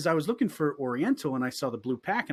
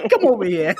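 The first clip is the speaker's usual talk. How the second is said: foreman calling; 6 semitones higher, 11 dB louder than usual. Retold or imitated. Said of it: "He's like".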